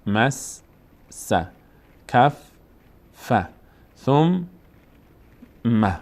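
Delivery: مس، س، کف، ف، ثم، م each sounded alone; long gaps between the halves of each doubled letter; split syllables. Shown as a demonstration. Teacher drilling tashdid examples, syllable by syllable. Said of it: "روی این دقت کرده باشن".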